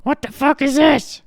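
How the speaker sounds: high, loud granny shout; angry granny yelling at her grandson; grandma shouting. Retold or imitated. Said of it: "in front of her".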